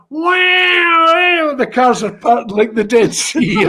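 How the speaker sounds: high, loud wonder; a teller amazed as traffic parts for the siren; amazed laughing shout. Real, not acted.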